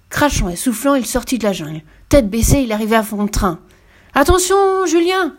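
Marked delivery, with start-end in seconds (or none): 4.1-5.3 much higher and louder yell; hippo's warning shout. A narrator's reading. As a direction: bellow a warning across the clearing. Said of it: "hurla Double Croup". (cri d'alarme puissant de l'hippopotame)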